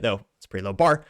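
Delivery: normal talking voice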